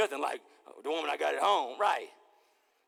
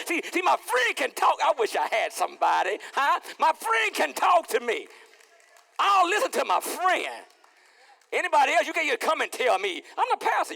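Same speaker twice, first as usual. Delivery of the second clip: loud insistence; 9 semitones higher, 7 dB louder than usual. Acted. Pastor shouting that only his friend may correct him. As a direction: declare it loudly to the church.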